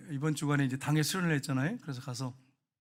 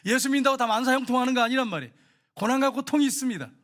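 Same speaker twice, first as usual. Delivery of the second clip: higher, louder sermon cry; full-force preaching; 7 semitones higher, 6 dB louder than usual. Real, not acted. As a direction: preach it out to the congregation.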